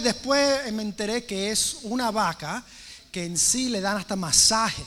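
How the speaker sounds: ordinary talk, nothing marked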